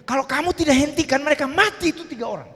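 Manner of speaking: high, urgent storytelling; tense retelling. Retold or imitated. Pastor reliving the beating before the crowd, tense and urgent. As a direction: rush the panic of the moment.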